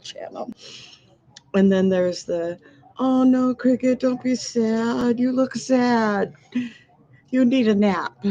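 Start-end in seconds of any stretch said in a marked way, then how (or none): 3.0-8.2 slow, cooing sympathy; gently cooing at the screen; fussing over Cricket. Retold or imitated. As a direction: soothe a sad-looking friend. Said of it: "this is me talking to Cricket".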